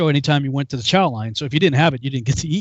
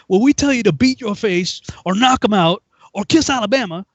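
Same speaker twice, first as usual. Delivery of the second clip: exasperated sergeant; 7 semitones higher than usual. Retold or imitated. Sergeant explains slang to recruit, mock exasperation. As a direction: spell it out like to a fool.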